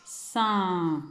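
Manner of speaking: "Sein" held long, falling on the nasal vowel; drawn-out syllable. Shown as a demonstration. Teacher asking for the last sound, "Sein" stretched out. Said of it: "le son que l'on entend en dernier".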